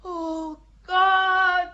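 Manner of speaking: anguished cry. Acted. Joan hearing her burning is near, anguished loud cry. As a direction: cry out in terror.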